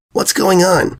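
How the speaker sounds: put-on high young filly, puzzled. Young Scootaloo asking her friends, high and puzzled. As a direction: ask it up high like a worried kid.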